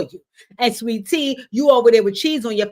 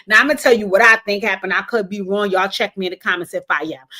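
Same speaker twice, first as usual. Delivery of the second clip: ordinary delivery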